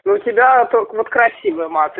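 usual speaking voice

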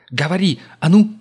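loud, sharp yelled command; shouting order. A narrator's reading. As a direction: shout at the prisoner. Gerda yells at captive.

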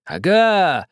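high, loud triumphant cry. A narrator's reading. Old man exclaiming at the captain in triumph. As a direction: crow in triumph. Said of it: "воскликнул старик с торжеством".

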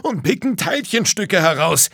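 high, heated complaint; worked-up tradesman's retort; quarrelling roofer. A narrator's reading. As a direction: argue back loudly and indignantly.

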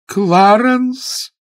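high, loud outraged cry; outraged call. A narrator's reading. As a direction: summon him in fury. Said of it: "обрела хотя бы одно слово".

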